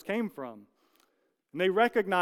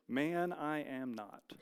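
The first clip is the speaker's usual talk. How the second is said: slow, much softer denial; slow hushed denial; 7 dB quieter, much slower than usual. Retold or imitated. Peter's second denial.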